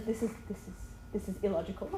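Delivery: low, soft mimicked bewilderment; lightly imitated helpless dad, hushed; baffled husband. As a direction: stammer it softly, at a loss. Imitates baffled husband handing child over.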